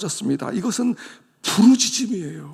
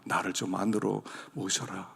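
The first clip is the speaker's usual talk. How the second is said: softer, lower tender promise; gentle invitation; 7 semitones lower, 6 dB quieter than usual. Acted. 문 밖의 주님이 성도들을 부르며, 낮고 부드러운 약속. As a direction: coax them to open the door.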